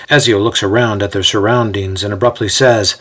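plain reading voice

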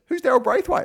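wife hearing a famous name she doesn't know, mock puzzled; mock baffled wife. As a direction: toss it up as if the name means nothing.

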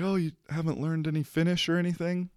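high, soft surprised question. Retold or imitated. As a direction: sound gently surprised. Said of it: "She's like".

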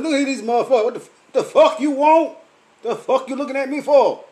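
loud, high, heated cussing out; mock-yell at the staring couple; telling off strangers who keep staring, loud and high; angry outburst.

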